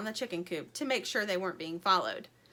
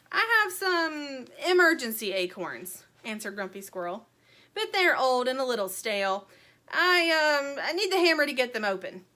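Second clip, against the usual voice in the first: stall, then hurry through the excuse; sly squirrel; 4 semitones higher, 5 dB louder than usual. A narrator's reading. Squirrel explains his emergency acorns.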